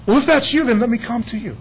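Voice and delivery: eager Peter. Retold or imitated. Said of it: "And Peter goes".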